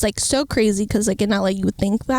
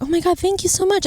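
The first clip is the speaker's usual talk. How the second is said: gushing thanks; 9 semitones higher, faster than usual. Retold or imitated.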